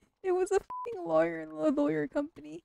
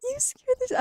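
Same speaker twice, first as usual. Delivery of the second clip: startled; 12 semitones higher than usual. Real, not acted. Just jump scared.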